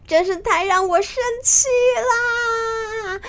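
bristle, then wail the last words high; very angry. Acted. Nezha answers being called a baby, high-pitched and furious. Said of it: "非常的生气".

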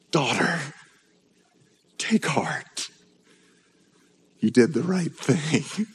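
soft, hushed and tender; "Daughter" spoken low and soft, full of kindness. Shown as a demonstration. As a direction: gently lift her up with the words. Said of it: "I want you to hear the tone".

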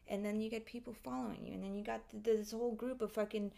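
ordinary talk

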